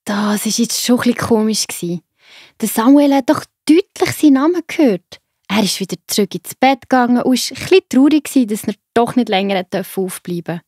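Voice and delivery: usual speaking voice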